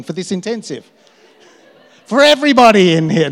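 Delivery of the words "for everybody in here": high, loud preacher call-out; mock-prophetic shout to everyone; loud declaration. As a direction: boom it at the room. Declares to the whole room.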